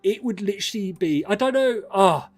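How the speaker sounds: giddy at the thought of rivals failing; excited. Real, not acted. Streamer thrilled at Arsenal bottling the league, excited. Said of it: "I'm getting excited just thinking about it".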